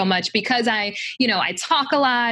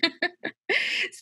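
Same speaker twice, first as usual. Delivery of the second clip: soft laugh; 9 semitones higher, 4 dB quieter than usual. Real, not acted. Laughing after host's joke.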